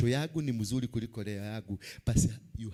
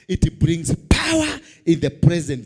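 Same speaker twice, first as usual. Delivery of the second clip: tv preacher; 5 semitones higher, 13 dB louder, slower than usual. Acted. Preaching climax.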